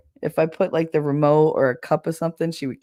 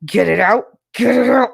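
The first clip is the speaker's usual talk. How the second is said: loud, rough demand building higher; desperate yell; 6 semitones higher, 8 dB louder than usual. Acted. Pregnant woman in her last two weeks, desperate yell. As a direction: yell it, rising to a growled outburst.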